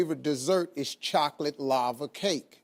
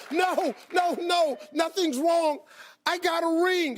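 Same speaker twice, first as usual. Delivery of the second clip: much higher, giddy voice, slowing on the nos; excited wife; 13 semitones higher than usual. Retold or imitated. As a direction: squeal it like a thrilled fiancée. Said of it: "So my wife goes".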